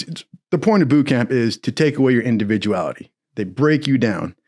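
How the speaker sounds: normal talking voice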